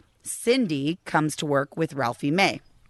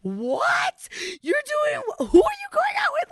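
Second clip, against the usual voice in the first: shocked co-host. Retold or imitated. Co-host hears she has a date, shocked squeal. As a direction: squeal in disbelief.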